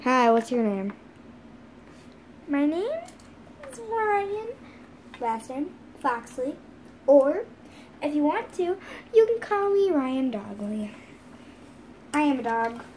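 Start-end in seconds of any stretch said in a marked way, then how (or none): none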